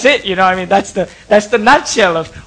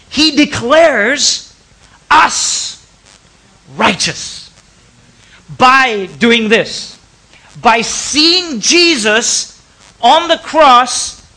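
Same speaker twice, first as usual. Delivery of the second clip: slow, weighty emphasis; 4 semitones higher, 3 dB louder, much slower than usual. Real, not acted. Preacher slows for emphasis.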